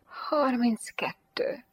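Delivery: slow breathy whisper on a sigh. A narrator's reading. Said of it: "sóhajtotta talpig Jane".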